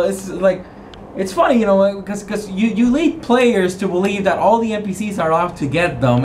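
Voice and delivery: ordinary talk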